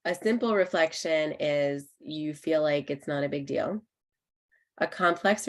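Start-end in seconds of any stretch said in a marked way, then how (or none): none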